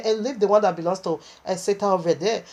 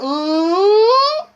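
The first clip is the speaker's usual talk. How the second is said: a high, loud bitter moan; bitter groan; 10 semitones higher, 6 dB louder than usual. Retold or imitated. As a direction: moan bitterly like a soul in torment. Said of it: "with a bitter sound voice again".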